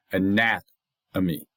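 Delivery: anatomy said slowly, leaning on the second syllable; stress on NAT. Shown as a demonstration. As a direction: draw out "anatomy" with the weight on NAT. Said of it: "so the nat is stressed".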